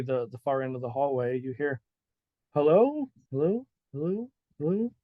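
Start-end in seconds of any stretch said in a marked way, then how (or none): 2.5-4.9 echoing call. Acted. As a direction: imitate a distant voice echoing down a hallway. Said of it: "it just, like, echoes".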